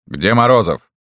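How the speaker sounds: loud bark of a question. A narrator's reading. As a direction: bark the demand. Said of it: "рявкнул Левкой".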